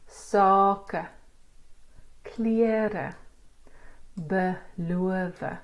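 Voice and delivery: "Sake, klere, beloof" with the stressed vowels drawn out; long open vowels. Shown as a demonstration. Teacher modelling words for learners to repeat, long vowels in "Sake, klere, beloof". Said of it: "it's a long sound".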